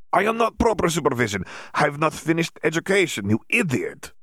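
put-on lightly accented, exasperated Bulgarian Viktor; Bulgarian-accented Victor. Victor scolding Dimitri, a hint of Bulgarian accent. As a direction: scold him with a mild Bulgarian lilt.

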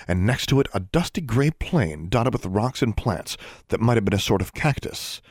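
usual speaking voice

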